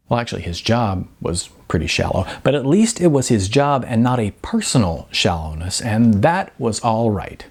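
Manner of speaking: ordinary delivery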